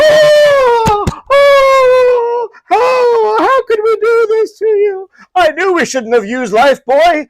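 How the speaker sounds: very high, loud mock sobbing; hammed-up wail of guilt-stricken parents; anguished wailing. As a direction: howl it out slowly in over-the-top remorse. Acting out parents' melodramatic remorse.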